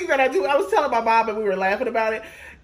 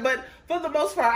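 usual speaking voice

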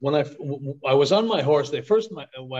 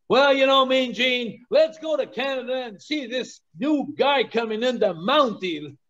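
Jesse the Body Ventura impression; 8 semitones higher than usual. Commentator by the ring tells Mean Gene, hyped and high. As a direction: hype it like a ringside commentator. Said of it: "and he was saying".

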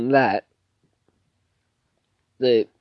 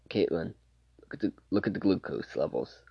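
low, very soft imitation of a discreet alert; hushed aside; 4 semitones lower, 10 dB quieter than usual. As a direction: quietly draw a colleague's attention. Acted quiet aside to colleague.

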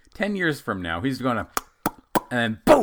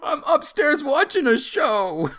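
high put-on lazy teen; mock teen voice; 14 semitones higher, 4 dB louder than usual. Teen son brushing off his dad in a high lazy voice. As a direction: toss it off like a lazy kid.

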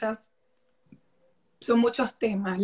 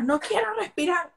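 put-on higher whiny child voice refusing to breathe; 7 semitones higher than usual. Mother voicing her angry child. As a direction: play the stubborn kid in a tantrum.

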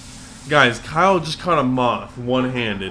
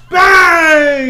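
shout; 15 dB louder than usual. Acted. Crude joke punchline shout.

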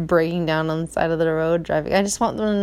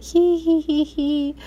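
high little giggle; mock kid giggle, high and light; giggling kids; 8 semitones higher, slower than usual. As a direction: giggle like a small boy. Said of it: "he and Jesse are like".

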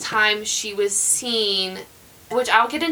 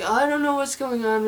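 groggy, confused; 4 semitones higher than usual. Retold or imitated. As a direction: imitate someone groggily saying they're lost. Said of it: "groggy and".

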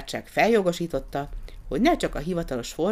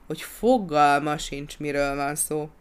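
usual speaking voice

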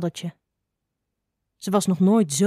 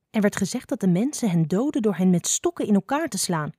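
plain reading voice

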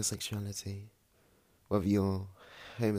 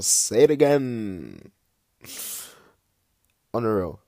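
louder, slow insistence; emphatic; 3 semitones higher, 9 dB louder, slower than usual. Real, not acted. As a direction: hammer the point home. Repeating point emphatically.

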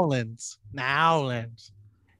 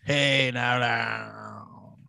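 mock New Orleans comic; 7 semitones lower, 4 dB quieter, much slower than usual. S0 imitating New Orleans comics, low put-on drawl. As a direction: lower drawled party voice. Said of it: "they're like".

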